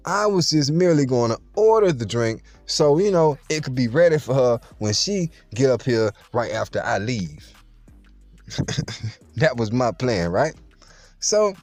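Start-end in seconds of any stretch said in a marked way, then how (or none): none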